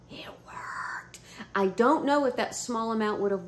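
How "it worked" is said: whispered it worked; awed whisper. Real, not acted. Telling viewers, awed whisper. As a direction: breathe it in amazement.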